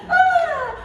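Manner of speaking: mock-emotional idol, voice cracking up high. Shown as a demonstration. Idol thanking fans, mock-tearful cracking voice. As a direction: squeak it out, slowly, like overcome. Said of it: "Uh, voice crack".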